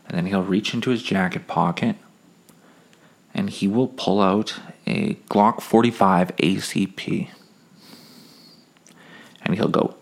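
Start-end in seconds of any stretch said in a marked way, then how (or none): none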